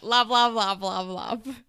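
loud, emphatic all-caps delivery; caps lock voice. Acted. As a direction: shout every word like it's in capitals. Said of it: "that's my caps lock voice".

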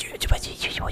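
whispering, imitating ASMR; mock ASMR whisper. Complaining about ASMR to co-host, mock whisper. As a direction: breathe it like an ASMR clip. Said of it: "mimicking ASMR".

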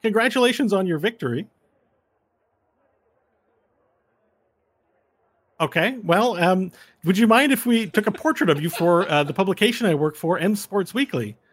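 high, loud fawning reporter voice; eager halfling reporter. Acted. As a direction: squeeze through and pitch politely. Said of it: "he goes".

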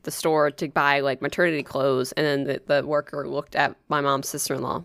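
ordinary talk

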